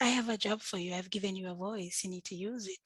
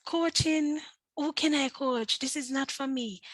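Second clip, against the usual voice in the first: incredulous protest; 6 semitones higher, 5 dB louder than usual. Retold or imitated. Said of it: "I had said".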